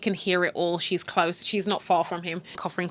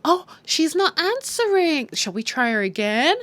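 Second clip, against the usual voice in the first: mock sweet voice; 8 semitones higher than usual. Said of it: "she's like".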